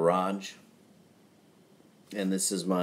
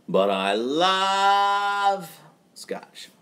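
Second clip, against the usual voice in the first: slow, high, stretched-out love for scotch; drawn-out declaration; 11 semitones higher, 4 dB louder, much slower than usual. Real, not acted. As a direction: savour every word of it. Ranking drinks, climax: scotch.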